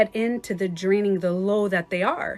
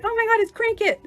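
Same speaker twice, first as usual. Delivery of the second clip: put-on much higher, delighted little-girl voice; excited toddler; 13 semitones higher, 4 dB louder than usual. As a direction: squeal it like a thrilled three-year-old. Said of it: "she's like".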